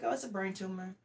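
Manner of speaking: casual, offhand retold reply; mildly breezy, no alarm at all; nonchalant. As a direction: toss it off lightly. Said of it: "All nonchalant, just like that".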